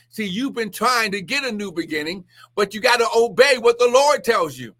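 ordinary talk

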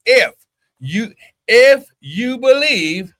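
high, loud and slow; fervent preaching. Acted. Preacher pressing the point on believers, fervent. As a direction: draw it out with rising fire.

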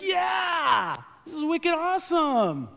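high, loud hammed-up cheer; imitating excited lady. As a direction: gush like a thrilled fan. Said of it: "she's like".

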